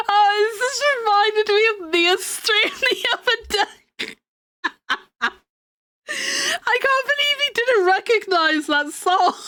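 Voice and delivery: high, laughing through words; bursting with giggles. Real, not acted. Laughing at memory.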